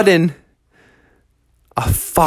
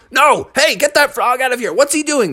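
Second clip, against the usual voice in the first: DJ objecting; 9 semitones higher than usual. A narrator's reading.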